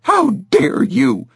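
How dare you pitched high and slow; outraged. A narrator's reading. Mother repeats her outrage.

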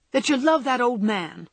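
loud jealous accusation. A narrator's reading. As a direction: spit out the accusation.

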